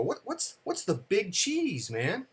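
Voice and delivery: high-pitched imitation of a puzzled kid; puzzled child. Retelling child's puzzled question to father.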